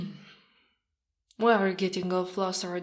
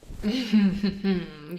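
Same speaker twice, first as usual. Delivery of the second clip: amused chuckle; 5 dB louder than usual. Acted. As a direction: chuckle fondly while cuddling. Cuddling his wife.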